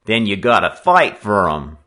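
loud gang-leader challenge; announcing a challenge. A narrator's reading. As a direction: lay down the terms loudly. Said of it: "he announced".

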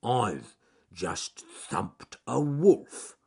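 very slow, ponderous words; mumbling bear. Acted. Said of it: "mumbled Charles Growly Bear".

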